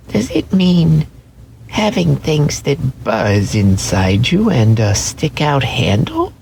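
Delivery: high, timid little rabbit; wide-eyed velveteen rabbit asking; small rabbit voice. Acted. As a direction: ask it like a shy toy rabbit. Rabbit voice, higher.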